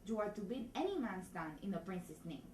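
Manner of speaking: put-on lines in a thick Spanish accent; measured Spanish-accented delivery. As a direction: play the constable with a comic Spanish accent. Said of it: "try it in Spanish".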